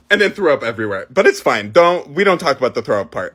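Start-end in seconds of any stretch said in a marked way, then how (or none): none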